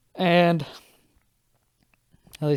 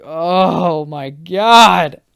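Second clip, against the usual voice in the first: slow drawn-out louder groan; exasperated groan; 3 semitones higher, 10 dB louder, much slower than usual. Retold or imitated. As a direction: stretch it out, fed up. Exasperated at friend.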